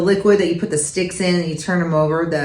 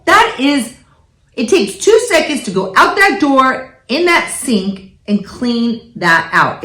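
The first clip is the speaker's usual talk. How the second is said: mock lecture; 5 semitones higher, slower than usual. Mom retelling her lecture to her daughter, mock exasperated.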